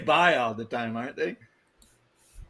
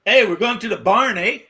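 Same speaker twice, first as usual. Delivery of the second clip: mock Canadian accent; 5 semitones higher, 6 dB louder than usual. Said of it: "You don't start a fucking sentence with eh".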